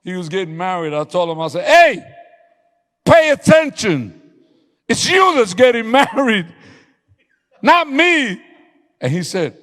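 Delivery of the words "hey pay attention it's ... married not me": loud high yell to wake him up; retold yelling. Pastor yelling at a groom who is falling asleep, loud. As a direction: bellow it at the groom. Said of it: "Why are you yelling, Pastor?".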